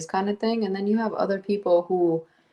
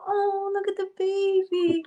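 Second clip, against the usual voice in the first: high, slow gushing coo; baby voice; slower than usual. Retold or imitated. Friend showing how she gushes at babies, high slow coo. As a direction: melt over the baby, way up high and drawn out. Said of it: "I see the baby, I'm like".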